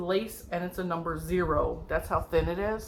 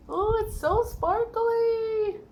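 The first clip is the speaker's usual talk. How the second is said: much higher, slow gushing squeal; delighted squeal; 14 semitones higher, much slower than usual. Real, not acted. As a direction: gush over the sparkle.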